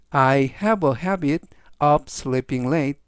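very slow, each word separated; slow, clear reading. Shown as a demonstration. Teacher slowly reads the sentence three times for students. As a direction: read slowly so students can follow. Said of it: "제가 역시 천천히 세 번씩 읽어드리고요".

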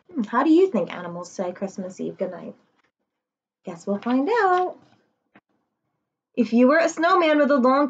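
gentle storybook rhyme reading; reading to children. Acted. Teacher reading a bedtime book to her class. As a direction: read the rhymes warmly to little kids.

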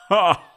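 one loud burst of a laugh; sharp laugh. Acted. Monster laughing at the sacrifice's retort, a loud single laugh. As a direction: bark out a single amused laugh. Monster amused by captive's reply.

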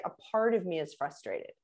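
calm, softly spoken softener; calm gentle phrasing. Shown as a demonstration. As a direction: say it gently to defuse. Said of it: "encourage people to do is say".

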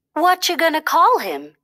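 high, eager little question; lightly voiced young bunny; curious bunny. A narrator's reading. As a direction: pitch it up like a young rabbit. Thumper voiced asking.

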